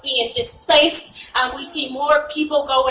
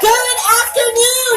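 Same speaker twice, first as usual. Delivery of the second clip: shouted greeting; 10 semitones higher, slower than usual. Real, not acted. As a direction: call out to get the crowd going. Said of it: "repeated greeting to crowd".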